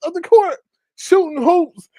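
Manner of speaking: very high, comic bewilderment; acted baffled amazement; intrigued disbelief. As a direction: marvel at it with the voice way up. Retold baffled fascination.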